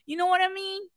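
high-pitched, hammed-up Long Island accent; mock Long Island accent. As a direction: play along in broad Long Island speech. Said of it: "You think [name] talks like this?".

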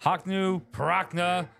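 high, garbled spell chant; mumbled incantation. Retold or imitated. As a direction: fumble through a magic spell. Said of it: "mumble mouth like an incantation".

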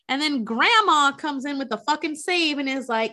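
normal talking voice

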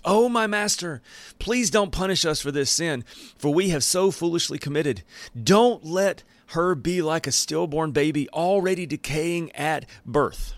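pleading Aaron. Retold or imitated. Aaron crying out to Moses.

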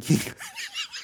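high breathy burst of giggling; can't hold back a giggle; real giggle. Real, not acted. Host giggles at the movie memory, high giggle. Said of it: "Sorry, I'm laughing".

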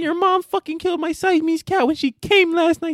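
imitating Shoresy. Shoresy bragging to teammates, high and cocky. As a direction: crow it in a squeaky voice. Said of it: "He said".

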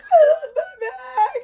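high, loud, slow sobbing wail; crying wail. Retold or imitated. To a pilot after losing her bag, a loud crying wail.

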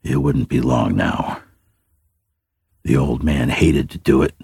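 plain reading voice

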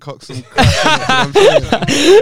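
laughing through the words; cracking up mid-sentence, rising high. Real, not acted. Joking about buying crack, laughing.

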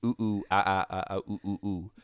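hooting like a big ape; a light ape hoot; ape noises. Pretend. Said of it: "King Kong will say".

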